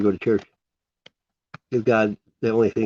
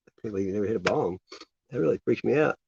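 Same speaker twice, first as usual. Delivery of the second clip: usual speaking voice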